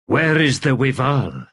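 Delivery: eerie, amused undead voice; an old ghost savouring its own tale; wraith Wither. A narrator's reading. Wraith to Kettle, amused and eerie.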